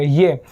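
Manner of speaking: ये said low and clearly, like English yeh; demonstrating J as ये, not yot; German J as ye. Shown as a demonstration. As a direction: drop low on ये. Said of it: "उसको ये पढ़ा जाएगा".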